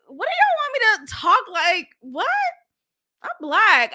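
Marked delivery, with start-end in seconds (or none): none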